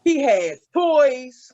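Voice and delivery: drawn out He has toys; heavy slow repetition. Shown as a demonstration. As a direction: stretch each word of "He has toys" out slowly. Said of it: "repeated emphasis".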